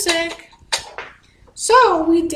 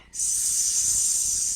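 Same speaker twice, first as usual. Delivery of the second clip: snake hiss; 8 dB quieter than usual. Shown as a demonstration. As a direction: breathe out in a long steady hiss. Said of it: "And a deep breath out".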